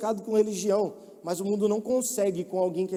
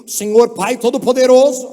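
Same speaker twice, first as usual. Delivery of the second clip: loud, pompous churchy prayer; mock pious believer; 3 semitones higher, 11 dB louder than usual. Mocking believers' fake prayer mask, loud and pompous. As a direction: ham up the fake holy voice. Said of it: "a máscara de crente".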